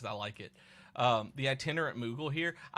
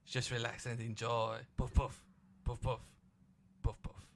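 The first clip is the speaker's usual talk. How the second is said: mock-French accent with popping puffs; Pink Panther. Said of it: "You sound like Pink Panther".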